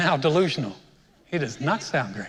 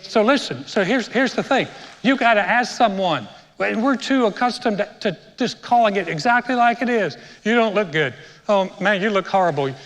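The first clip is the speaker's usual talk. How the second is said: higher and louder, driving the point hard; fiery preaching; 4 semitones higher, 4 dB louder than usual. Real, not acted. Preacher urging the congregation about blunt talk, loud and fired up. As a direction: preach it with full force to the crowd.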